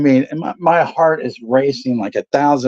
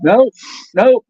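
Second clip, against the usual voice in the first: insisting; 9 semitones higher, 4 dB louder than usual. Retold or imitated. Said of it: "I'm like".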